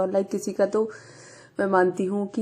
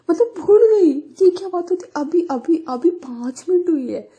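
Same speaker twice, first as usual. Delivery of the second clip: much higher and louder, near-crying outrage; outraged; 9 semitones higher, 6 dB louder than usual. Retold or imitated. Vani outraged that her friend forgot her, near-crying shout. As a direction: shriek the disbelief, close to tears.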